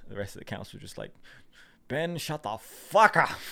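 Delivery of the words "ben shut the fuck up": slow, louder mock snap; mock founding father yelling at Ben; mock exasperated snap. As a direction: tell Ben off in a hammed-up snap. Council telling Ben off.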